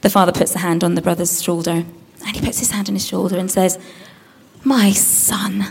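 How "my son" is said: soft whispered fatherly address; tender whisper. Acted. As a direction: whisper it lovingly.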